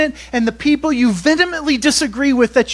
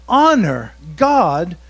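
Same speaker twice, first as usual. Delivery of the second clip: slow, firm stress on Honor; deliberate, slow and firm; slow command; 4 semitones lower, 3 dB louder, much slower than usual. Acted. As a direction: lay down the commandment. Command from scripture.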